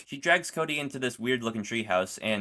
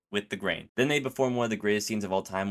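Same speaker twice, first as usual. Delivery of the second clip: usual speaking voice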